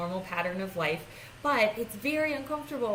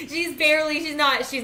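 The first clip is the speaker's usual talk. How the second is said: bursts out laughing, louder and higher; real laughter; 8 semitones higher, 10 dB louder than usual. Real, not acted. Laughing at the dog who barely walks, real laughter. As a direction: laugh through the words at the dog.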